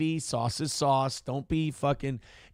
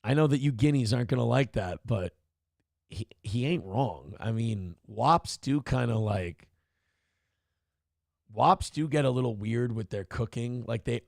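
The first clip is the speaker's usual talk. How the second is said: usual speaking voice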